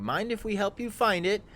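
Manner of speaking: high, loud, friendly call trying to sound harmless; non-threatening greeting. Pretend. Skullface calls down to the bugbears, harmless and friendly. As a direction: call out brightly so you sound like no threat at all. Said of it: "least amount of threat in my voice".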